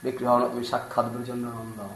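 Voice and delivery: softly, in awe of the deity; awed Bengali murmur; hushed reverent quote. Acted. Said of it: "immediately Vigraha".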